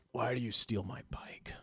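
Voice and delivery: whispered mock-menacing accusation; imposing big man. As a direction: confront him in a hushed, whispered voice. Huge imposing man confronts him.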